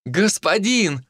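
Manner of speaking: put-on high, drawn-out girlish cry; ringing girls' voices. A narrator's reading. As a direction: call out as eager young girls. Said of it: "звонкие девичьи голоса".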